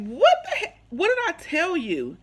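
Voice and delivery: high, loud retold outrage; outraged telling-off, starting very high; indignant outburst. Retells outburst at his advances.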